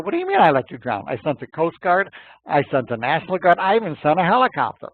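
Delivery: loud, high, indignant comeback; exasperated retort. Retold or imitated. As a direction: fire back in disbelief, building to the last item. God retorts to the raging minister.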